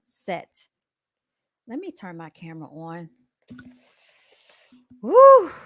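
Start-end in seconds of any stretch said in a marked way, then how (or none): 5.0-5.5 high loud whoo of heat; hot whoo. Real, not acted. Vlogger reacting to the clip, loud high whoo. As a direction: let out a loud whoo, fanning yourself. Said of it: "I done got hot up in here".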